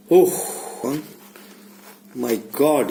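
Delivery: ordinary talk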